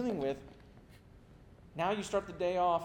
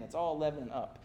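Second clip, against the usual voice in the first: ordinary talk, nothing marked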